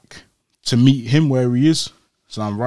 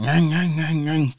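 higher mock engine ngang; revving engine noise; 4 semitones higher, slower than usual. Imitating the van redlining, higher. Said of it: "the van's going like".

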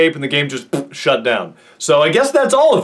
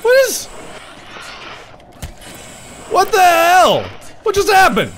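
baffled; 10 semitones higher than usual. Real, not acted. Baffled by game turn.

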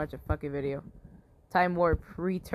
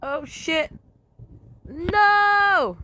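much higher, loud cry of dismay; dismayed shout; 13 semitones higher, 9 dB louder, much slower than usual. Real, not acted. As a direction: yelp as it all goes wrong. Units dying in game.

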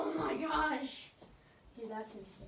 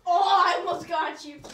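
loud; 13 dB louder than usual. Real, not acted. Near miss in game.

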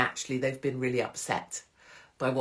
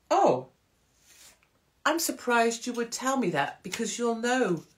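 high, measured show of surprise; demonstrating a politely wounded comeback; mock surprise; 7 semitones higher than usual. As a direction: act pointedly taken aback, pitched high. Models a pointed reply to gossip.

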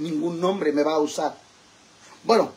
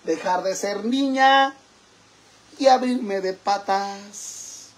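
proud, theatrical manifesto delivery; dramatic recitation; 6 semitones higher, 4 dB louder than usual. Acted. Drag persona reciting a mock poem to her followers, theatrical. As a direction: declaim it like a mock feminist poem.

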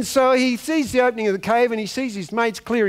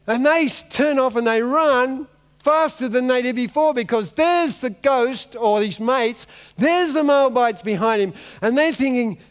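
ordinary delivery

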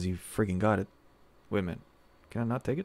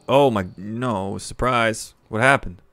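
loud dismay; 8 dB louder than usual. Real, not acted. Sudden battle setback.

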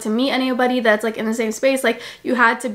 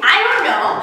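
laughing it off, higher; 5 semitones higher than usual. Real, not acted. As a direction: giggle through the words. Laughing reply to dog.

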